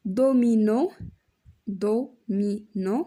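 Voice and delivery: do-mi-no slowly drawn out; syllable by syllable. Shown as a demonstration. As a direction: stretch the syllables apart. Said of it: "سيلاب فيها E". (تقطيع المقطع ببطء)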